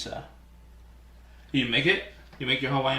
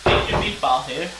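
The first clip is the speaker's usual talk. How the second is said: louder stadium-vendor shout; vendor call; 6 dB louder than usual. Pretend. Playful vendor call to the chat about meatballs. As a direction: hawk it like a ballpark seller.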